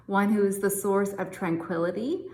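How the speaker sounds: normal talking voice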